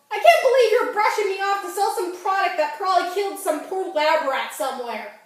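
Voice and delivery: high, loud outrage at being cut off; indignant rant. Acted. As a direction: tell off the radio host in disbelief.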